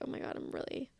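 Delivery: whispered, breathless oh my God; a hushed, stressed little aside to yourself; overwhelmed whisper. Retold or imitated. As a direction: let it slip out under your breath, overwhelmed. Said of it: "so I'm like".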